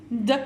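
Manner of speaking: "d" as a short, isolated sound; clipped-off "d" sound on its own; isolated letter sound. Shown as a demonstration. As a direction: say just the sound "d", not the letter name. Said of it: "J'entends le son".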